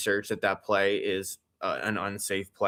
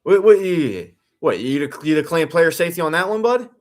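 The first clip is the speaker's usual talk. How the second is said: loud, higher, fast disbelieving rant; incredulous outburst; 7 semitones higher, 6 dB louder than usual. Real, not acted. Streamer yelling at the Bills coach, loud and incredulous. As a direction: fire it at him in disbelief.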